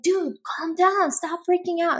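mock exasperation. Spouse overreacting to stressed partner, mock exasperated. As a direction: act out an irritated spouse telling someone off. Acting out the spouse's wrong reaction.

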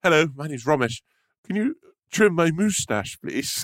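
mock him as posh; teasing posh impression. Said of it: "imitating him".

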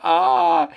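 high, loud, long mock scream; flying scream. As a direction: scream as if flung through the air. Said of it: "Y Floyd grita". (grito agudo y largo)

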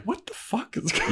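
high-pitched mock bewilderment; baffled. Imitating Xander as Anya storms out, baffled squeak. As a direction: squeak it out, lost for an explanation.

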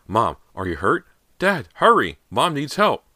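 high, loud, urgent calling; alarmed cry for help. Acted. Daughter calling Dad to her bloodied mother, alarmed cry.

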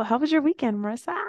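squeaky high question; high-pitched. Real, not acted. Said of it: "Why did I say it like that?".

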